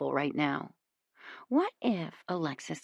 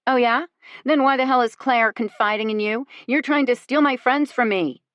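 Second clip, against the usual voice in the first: higher, louder angry accusation; 5 semitones higher, 5 dB louder than usual. A narrator's reading. Lexi accuses Alexis of stealing her friends, angry. Heated quarrel.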